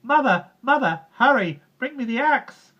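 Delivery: loud, high urgent yell; high panicked call. A narrator's reading. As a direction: shout down to mother for help. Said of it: "Jack called out".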